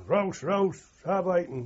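barked German orders, loud and high; German guard shouting. Retold or imitated. German guard orders prisoners off the truck, shouting. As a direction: bark it like a guard rousting prisoners. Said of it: "And he's shouting".

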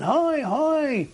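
lightly re-enacted high hail; retold shouting. Survivors waving and calling to the ship, mild mock shout. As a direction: call up to the ship while waving. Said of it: "started waving then and shouting".

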